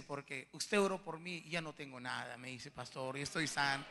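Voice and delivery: very soft, low voice; hushed retold testimony. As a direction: quote the healing news almost under the breath. Retells quiet testimony of healing. (testimonio en voz baja, contenida)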